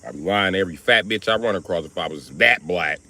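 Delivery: ordinary delivery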